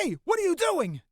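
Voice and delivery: high, loud panicked yell; alarmed shout. Acted. Crew member to attacker on the plane, alarmed shout.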